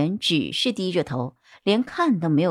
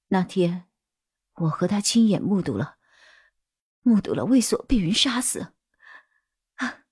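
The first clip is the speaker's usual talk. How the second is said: halting, a touch of dread, rising; trembling witness; 3 semitones higher than usual. A narrator's reading. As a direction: recount the killing in broken pieces. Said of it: "一边身体开始颤抖了起来". (颤抖着、断断续续地回忆)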